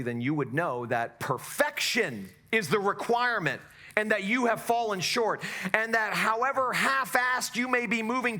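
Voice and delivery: rising, emphatic preaching; preaching at full force. Acted. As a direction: drive it home from the pulpit.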